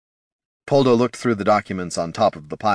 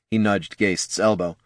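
ordinary delivery, nothing marked

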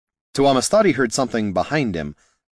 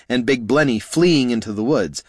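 usual speaking voice

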